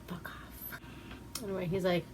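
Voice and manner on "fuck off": whispered dismissal. Real, not acted. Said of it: "It's like, no".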